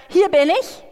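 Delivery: eager announcement. Acted. Petrus arrives at the Gentiles' door, loudly presenting himself. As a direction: announce yourself proudly.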